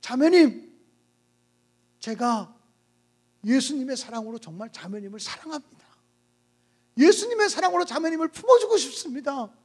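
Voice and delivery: pastor's tender earnest appeal; earnest plea. Acted. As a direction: speak gently from the heart. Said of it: "더 간절한 마음으로".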